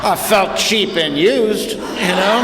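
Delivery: high, drawn-out comic complaint; mock wounded. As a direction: play hurt, slow and high-pitched. Comic punchline after being shown off.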